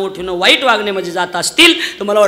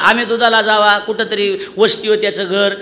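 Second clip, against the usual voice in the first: usual speaking voice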